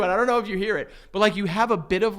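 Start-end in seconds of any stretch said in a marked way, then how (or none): none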